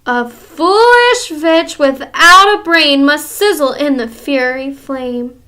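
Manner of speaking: slow, loud chanted threat; menacing recital. Acted. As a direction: recite the doom slowly.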